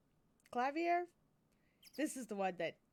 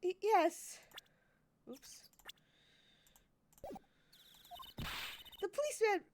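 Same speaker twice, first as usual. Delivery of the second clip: put-on flustered girl; 8 semitones higher, 4 dB louder than usual. Player voices flustered Athena.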